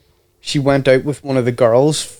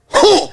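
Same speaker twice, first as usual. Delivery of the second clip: abrupt high-pitched "huh"; mock snubbing huh; imitated huffy grunt; 14 semitones higher than usual. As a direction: mimic her dismissive huff, pitched way up. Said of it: "She would go".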